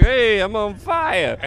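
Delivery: high, drawn-out showboating; mock cocky. Comic recalling his hot streak with waitress, cocky. Bragging after getting his laughs.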